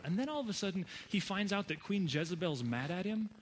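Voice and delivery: ordinary delivery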